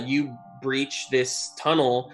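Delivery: ordinary talk, nothing marked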